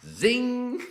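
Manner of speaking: high sharp zing sound; fishing line zing. Retold or imitated. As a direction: snap out a reel-cast noise. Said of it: "and goes".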